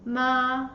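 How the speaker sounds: "Ma" held long on a flat, level pitch; "Ma" sustained without any rise or fall; first tone. Shown as a demonstration. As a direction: say "Ma" in Mandarin first tone, perfectly level. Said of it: "The first tone is flat".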